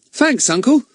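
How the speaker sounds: put-on high, eager boy's voice; Ern voice. Nephew Ern thanks his uncle, high and eager. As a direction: perk up like a cheeky lad.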